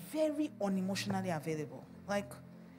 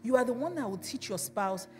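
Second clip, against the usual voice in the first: normal talking voice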